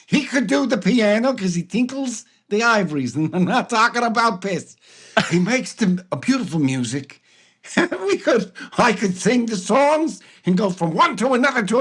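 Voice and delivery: high, lisping cartoon king, giggling; high, giggly cartoon monarch; King Candy voice. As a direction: play the gleeful candy king. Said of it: "as King Candy".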